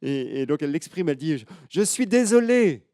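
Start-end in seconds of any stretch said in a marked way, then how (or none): 1.4-2.8 put-on high, loud, slow child's apology; sorry little girl. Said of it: "elle culpabilise un petit peu".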